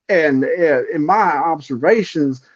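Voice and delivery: hammed-up hillbilly playing a documentary narrator. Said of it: "you don't wanna hear Cletus or Clyde".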